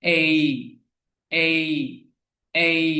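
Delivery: এই held long, gliding from "eh" into "ee"; long vowel sound. Shown as a demonstration. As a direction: model এই as a long "ay", each one separate. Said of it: "খেয়াল করুন".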